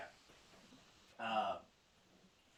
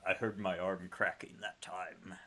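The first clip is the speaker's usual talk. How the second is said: ordinary delivery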